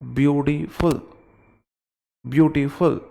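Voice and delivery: ब्यूटीफुल split as ब्यूटी, gap, फुल. Shown as a demonstration. Teacher shows students a syllable break in beautiful. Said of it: "ब्यूटी और फुल बीच में gap आया".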